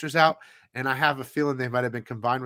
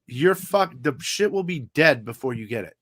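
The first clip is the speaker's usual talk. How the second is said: normal talking voice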